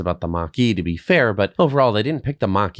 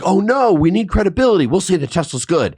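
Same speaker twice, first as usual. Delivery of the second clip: higher, louder mimicked fretting; mock panic; 8 semitones higher, 4 dB louder than usual. Host imitating reviewers needing credibility, mock-worried voice. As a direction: play the magazine fussing over its image. Mimicking magazine's fickle reasoning.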